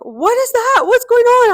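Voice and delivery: put-on high, loud child's alarmed voice; startled kid calling out from the next room; high startled child asking what happened; alarmed little daughter. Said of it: "My daughter was like".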